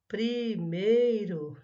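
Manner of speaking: "Primeiro" loudly stretched as pri-mei-ro; syllable by syllable. Shown as a demonstration. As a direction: slowly sound out each syllable of "Primeiro". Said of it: "Separe em sílabas as palavras". ("Primeiro" devagar e forte, separado em sílabas)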